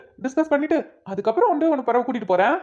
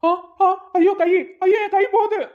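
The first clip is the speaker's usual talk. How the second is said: crying out in pain; 6 semitones higher than usual. Acted. Man whose arm is twisted begs the woman to let go, wailing in pain. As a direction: yelp and plead as your arm is twisted.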